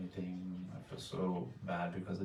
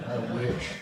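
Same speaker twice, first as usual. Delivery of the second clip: a louder, higher exclaimed wish; loud joking wish; 3 semitones higher, 8 dB louder than usual. Real, not acted. Joking to friends about being up late, loud exclaim. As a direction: blurt it out as a wistful joke.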